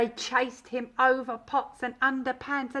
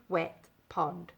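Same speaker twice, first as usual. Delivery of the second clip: usual speaking voice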